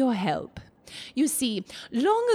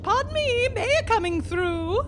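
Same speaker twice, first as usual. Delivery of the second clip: calling out; 10 semitones higher than usual. Acted. Mayor pushing through a crowd.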